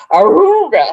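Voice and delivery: put-on honking like an old-timey car horn; loud vintage horn imitation. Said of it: "makes this old-timey car horn".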